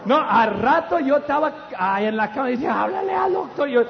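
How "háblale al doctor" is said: urgent plea. Retold or imitated. Burned husband begging his wife to call the doctor, urgent. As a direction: call out desperately for help.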